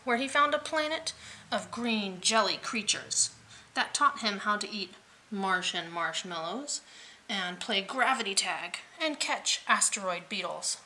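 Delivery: usual speaking voice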